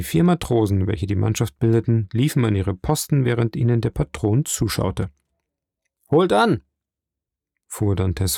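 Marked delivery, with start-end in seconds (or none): 6.1-6.6 brisk, high-pitched command; calling out an order. A narrator's reading. Dantes continues directing the sailors, calling out. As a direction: give the crew a raised-voice order.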